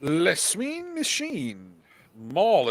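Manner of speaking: a lightly put-on commentator voice. Breaking down Maul against Obi-Wan in a lightly put-on voice. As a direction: hold the bit, slow on the opener. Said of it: "been doing that voice for two hours".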